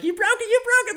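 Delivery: very high panicked squeal; mock freak-out. [name] blames Jonathan for the broken key, squealed mock panic. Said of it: "They freak out".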